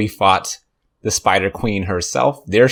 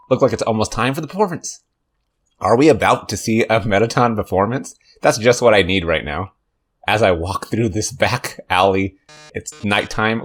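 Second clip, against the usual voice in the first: ordinary delivery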